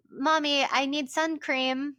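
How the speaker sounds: put-on high, drawn-out small-child voice; little daughter. Mom imitates her daughter asking for sunscreen, little-girl voice. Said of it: "She'll be like".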